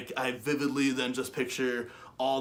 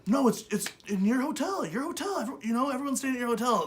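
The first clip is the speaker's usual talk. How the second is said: insisting friend; 10 semitones higher than usual. Retold or imitated.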